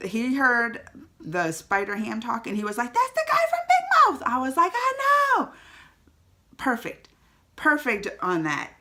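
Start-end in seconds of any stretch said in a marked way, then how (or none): 2.9-4.2 high, fast excited squeal; excited kid's outburst. Retold or imitated. Mom imitating her son recognizing a voice, excitedly. 4.7-5.5 high-pitched gushing reply; excited agreement. Retold or imitated. Mom replaying her answer to her son, excitedly.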